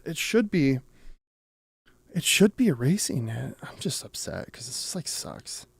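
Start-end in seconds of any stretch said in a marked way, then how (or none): none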